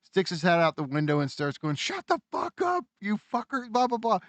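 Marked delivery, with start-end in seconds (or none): 1.8-4.2 much higher mock yell of an upstairs neighbour; mock angry yell. Upstairs neighbour yells at the arguing couple, mock angry yell. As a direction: bark it like a fed-up neighbour.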